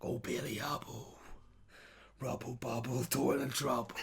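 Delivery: Cockney rhyme. Shown as a demonstration. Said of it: "your Cockney".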